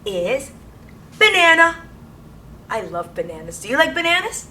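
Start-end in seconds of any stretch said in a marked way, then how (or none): 1.2-1.8 high, loud "banana"; big classroom reveal; excited reveal. Acted. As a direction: announce it like a surprise. Revealing the card.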